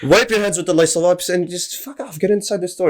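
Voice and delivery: exasperated retort. Retold or imitated. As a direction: beg to be left alone. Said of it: "I'm like".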